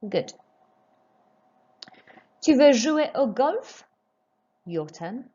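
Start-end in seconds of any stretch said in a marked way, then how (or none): 2.4-3.9 slow, raised, golf lifts at the end; French question, rising on golf; rising question tune. Shown as a demonstration. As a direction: model the question, ending on a rise at golf. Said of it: "raise your voice just before the question mark".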